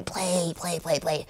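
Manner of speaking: soft under-the-breath repeats; muttered pleading. Retold or imitated. To the stuck DVD player, soft muttered pleading. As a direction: coax the stuck player quietly.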